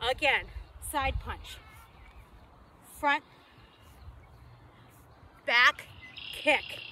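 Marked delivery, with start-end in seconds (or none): 3.0-6.8 loud coaching call. Real, not acted. Calling moves to fitness class.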